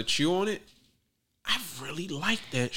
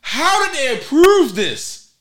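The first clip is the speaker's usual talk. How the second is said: outraged exclaim; 9 semitones higher, 9 dB louder, slower than usual. Retold or imitated. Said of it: "And I'm like".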